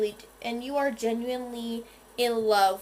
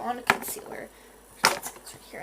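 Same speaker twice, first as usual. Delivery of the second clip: ordinary talk, nothing marked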